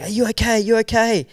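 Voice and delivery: lightly acted, high stewardess voice; worried stewardess. Stewardess checking on the passenger who got through, lightly acted concern.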